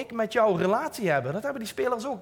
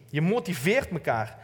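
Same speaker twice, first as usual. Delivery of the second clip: ordinary talk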